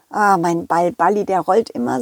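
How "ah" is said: plain reading voice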